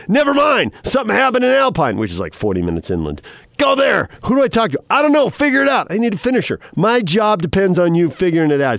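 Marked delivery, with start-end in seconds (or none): 0.1-2.0 high, pressing newsroom command; urgent, higher-pitched retold order; acted urgent order. Acting out news director barking orders. 3.6-8.8 high, insistent retold orders; urgent and demanding, pitched high; acted impatient boss. Acting out impatient news director's orders.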